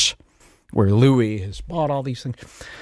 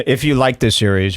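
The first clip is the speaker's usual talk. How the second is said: ordinary talk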